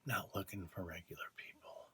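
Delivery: unvoiced whisper under the breath; hushed conspiratorial aside. Real, not acted. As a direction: slip it out as a secret.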